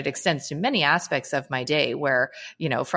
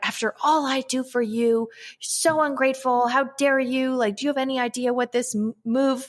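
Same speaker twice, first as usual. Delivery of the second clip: higher, hammed-up aggrieved mother; mock indignant rant; 7 semitones higher than usual. Mom voicing her unspoken resentment at her child, higher mock indignation. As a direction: play the martyred, ungrateful-kids outburst. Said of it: "the sentiment would have been like".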